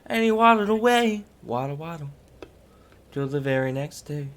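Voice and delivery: high sing-song storyteller, then settling; lightly voiced nursery tale; storybook punchline. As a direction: lilt it like a kids' song.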